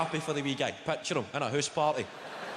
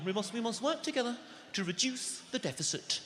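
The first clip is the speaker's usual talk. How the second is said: a light posh politician voice, softer; David Cameron impression; 7 semitones higher, 5 dB quieter than usual. Comic mocking a prime minister's speech, light impression. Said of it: "David Cameron".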